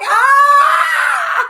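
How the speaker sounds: high, loud shriek; panicked scream. Retold or imitated. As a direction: scream in helpless alarm. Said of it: "and I'm like".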